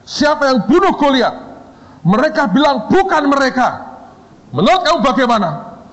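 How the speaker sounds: the boss grilling a shaking student; stern founder. Retold or imitated. Founder questions the teacher.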